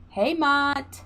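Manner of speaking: ordinary talk, nothing marked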